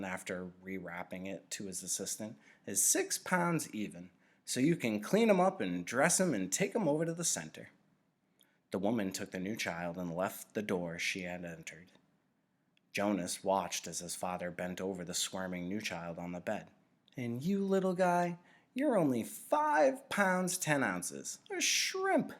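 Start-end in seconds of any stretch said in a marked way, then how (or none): 2.6-7.7 lightly voiced, slightly higher and louder, friendly; a kindly nurturer chatting to his assistant, a hint of a voice; cheerful father nurturer. 17.2-22.3 put-on much higher, slow, doting baby talk; special baby voice. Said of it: "That's the special voice he uses with Gabriel".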